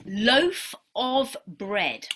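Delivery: slowly drawn-out clue; slow quiz phrase. Shown as a demonstration. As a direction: say it slowly for the class. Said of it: "I'm going to say my first one".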